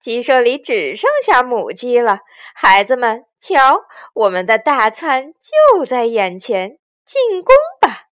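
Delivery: rally the gang like a bandit chief; odd-voiced, greedy battle call; 强盗巴巴. A narrator's reading. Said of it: "怪声笑道".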